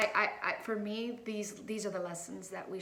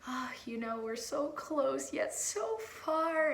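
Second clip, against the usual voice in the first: tearful sigh; 5 semitones higher, slower than usual. Retold or imitated. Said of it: "And so I was like".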